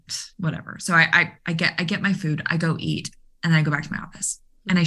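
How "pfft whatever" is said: dismissive scoff. Retold or imitated. Coach shrugging off the joke.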